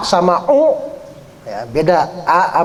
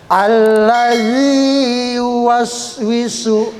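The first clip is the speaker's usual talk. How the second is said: zal as th sound; 4 semitones higher, much slower than usual. Shown as a demonstration. Teacher modelling the zal sound, slow chant. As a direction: bite the tongue tip for the z in "Allazi".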